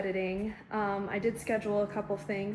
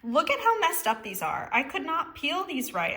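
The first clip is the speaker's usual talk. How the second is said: higher, indignant complaint; mad; 6 semitones higher, 4 dB louder than usual. Real, not acted. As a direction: complain loudly about the eggs. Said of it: "I'm just too mad right now".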